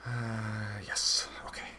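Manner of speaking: hushed, hesitant whisper; whispered self-talk. Acted. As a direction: whisper it privately to yourself.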